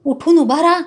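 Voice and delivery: loud roar, then slowing on the second line; a bandit roaring an order. Acted. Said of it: "कुऱ्हाड उगारून गरजला".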